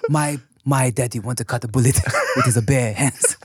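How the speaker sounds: mock Chinese accent breaking into laughter; Jackie Chan. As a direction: imitate a broken Chinese accent, laughing through it. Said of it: "playing young Jackie Chan or something".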